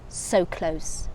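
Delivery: usual speaking voice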